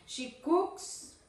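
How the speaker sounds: "cooks" with a clear final s, rising; verb S sounded. Shown as a demonstration. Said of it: "Después las S de los verbos".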